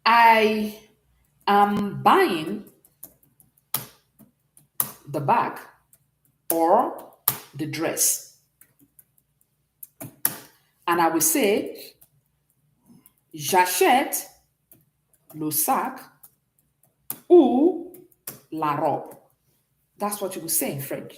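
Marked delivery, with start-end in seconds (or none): 0.1-8.4 spaced out, as if writing along; word-by-word dictation. Shown as a demonstration. Teacher giving an English example to translate, word by word. 13.3-19.1 word-by-word dictation. Shown as a demonstration. Teacher translating the example into French, chunk by chunk.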